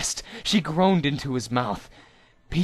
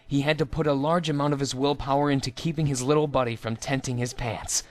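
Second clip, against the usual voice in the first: ordinary delivery, nothing marked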